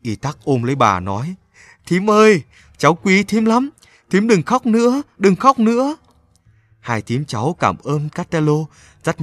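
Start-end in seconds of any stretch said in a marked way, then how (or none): 1.9-6.0 put-on high, louder boy's pleading voice; begging her to stop crying; comforting boy. A narrator's reading. Boy hugging his weeping aunt, pleading tenderly.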